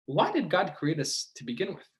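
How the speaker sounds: ordinary talk, nothing marked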